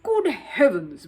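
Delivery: loud, slow astonished boom; startled official booms at the crowd. Acted. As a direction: let it ring out in shock. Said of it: "boomed Sir Huntley".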